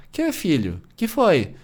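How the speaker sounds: gentle mother's voice, rising high on "Que foi?"; patient, caring mother answering her son; sweet mother. Retold or imitated.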